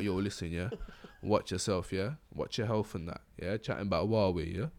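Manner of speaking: lightly voiced phone telling him off; phone's warning voice. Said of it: "They're like".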